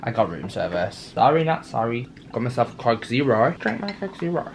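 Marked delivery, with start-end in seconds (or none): none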